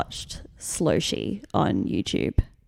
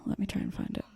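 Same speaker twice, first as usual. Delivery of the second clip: whisper; 13 dB quieter, faster than usual. Real, not acted. Searching the video, muttering.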